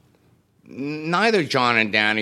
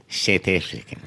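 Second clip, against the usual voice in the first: "South African" as "Seth Ifikan", vowels flattened; South African accent; 4 semitones lower than usual. Shown as a demonstration. Drummer's accent mimicked for the host. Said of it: "That's my impression of a South African accent".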